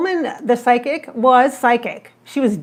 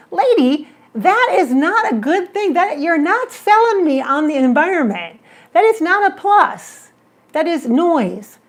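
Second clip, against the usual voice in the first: exasperated; 6 semitones higher, 4 dB louder than usual. Retold or imitated. Telling off the psychic.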